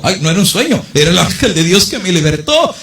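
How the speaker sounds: a touch of wonder as freed Peter; Peter amazed. Acted. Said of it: "cuando Pedro está fuera de la cárcel dijo".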